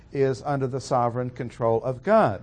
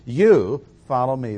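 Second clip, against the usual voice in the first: "You" punched louder; stress on you; 5 dB louder than usual. Shown as a demonstration. Preacher pressing the emphatic "You" home. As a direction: strike "You" hard. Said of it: "are emphatic".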